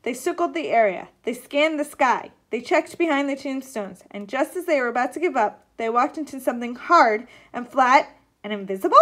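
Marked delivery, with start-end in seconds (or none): none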